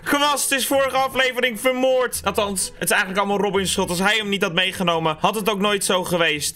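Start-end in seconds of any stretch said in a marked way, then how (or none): none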